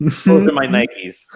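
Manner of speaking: imitated indignation. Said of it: "he's like".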